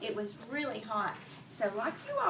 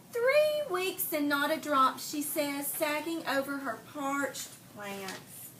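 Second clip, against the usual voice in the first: put-on slow, expressive read-aloud to kids; storybook narration; 4 semitones higher, 3 dB louder, slower than usual. A narrator's reading. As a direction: read it like story time for the class. Reading picture book to children.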